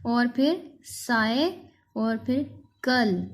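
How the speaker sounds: slow, separate बाय, साय, कल; read part by part. Shown as a demonstration. Teacher breaking cycle up for pupils, slow separate parts. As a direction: set बाय, साय and कल apart with pauses. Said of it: "इसको ऐसे पढ़ेंगे".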